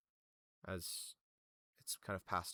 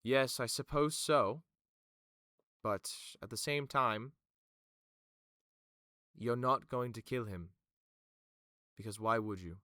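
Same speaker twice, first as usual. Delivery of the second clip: Vima; 2 semitones higher, 5 dB louder than usual. Acted. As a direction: concede reluctantly, then reassert authority. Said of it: "And Vima just goes".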